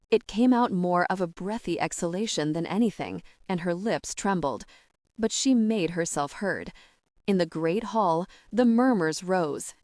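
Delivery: ordinary delivery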